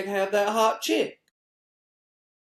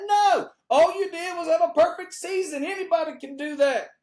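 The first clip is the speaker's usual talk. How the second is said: mock scolding; 8 semitones higher than usual. Taunting the undefeated team, mock outraged scolding. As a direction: scold them, hammed up.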